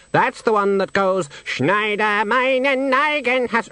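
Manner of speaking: a snooty bird sneering; haughty swan. Acted. The swan scoffing at Death's song suggestion, high and disdainful.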